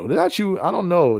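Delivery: mock suspicious squeak; a playful, pointed challenge; teasing high question. Teasing accusation repeated.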